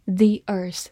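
long ee in "the" before the vowel of "earth"; the as "thee". Shown as a demonstration. Teacher showing the article before a vowel, "thee earth". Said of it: "er这个音是一个元音，所以读作".

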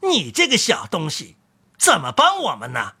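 a lightly voiced doubting crook; sceptical thief. A narrator's reading. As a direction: size him up with a sneer. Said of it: "说：".

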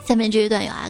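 usual speaking voice

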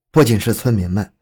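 ordinary delivery

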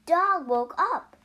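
ordinary delivery

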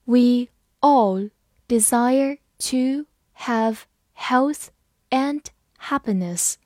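slow, word by word; slow reading. Shown as a demonstration. Teacher reading slowly so students follow. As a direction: read it slowly for the class. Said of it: "好，慢慢来读。".

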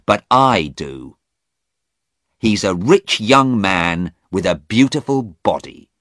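a touch of jealous anger; angry young man. Acted. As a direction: spit out his jealousy. Stephen voiced, angry.

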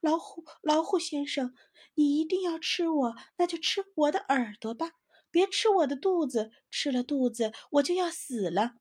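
high, pleading mock-pitiful whine. Squirrel pleads with the tiger in a put-on pitiful voice. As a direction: beg for mercy, playing helpless. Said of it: "用可怜的声音说".

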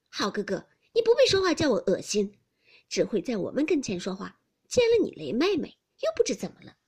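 lightly acted taunt; lightly voiced mocking girl. A narrator's reading. Xiangyun mocks Baoyu.

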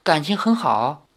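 high, tentative question; suppressed unease. A narrator's reading. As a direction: ask carefully, holding worry back. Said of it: "强忍着不安问道". (强忍不安地试探)

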